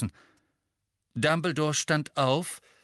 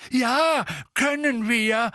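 higher, louder offended retort; indignant; 12 semitones higher, 6 dB louder, slower than usual. A narrator's reading. Misses Figg answering Fudge, indignant. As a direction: bristle at the doubt. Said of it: "entrüstet".

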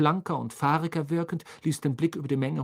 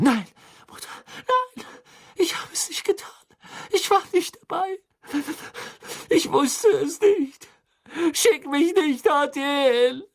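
high, crying, desperate pleading that builds louder; screaming; 6 dB louder than usual. A narrator's reading. Sentenced boy begs his mother, screaming in terror. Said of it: "begann der Junge zu schreien".

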